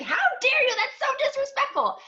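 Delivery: high, loud, almost crying outrage; furious mom. Retold or imitated. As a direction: wail it like a deeply offended mother. Mom ten times more pissed.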